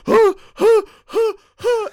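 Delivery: imitating crying hard at the movie; mock sobbing gasps. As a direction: fake big blubbering gasps in the cinema. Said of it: "I know I'm gonna cry like a bitch".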